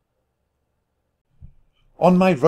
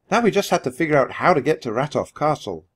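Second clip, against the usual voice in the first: plain reading voice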